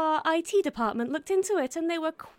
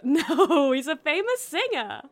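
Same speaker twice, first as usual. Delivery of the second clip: laughing while explaining the joke; 3 semitones higher, 6 dB louder, slower than usual. Acted. Explaining the joke to a friend, giggling. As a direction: chuckle through the explanation.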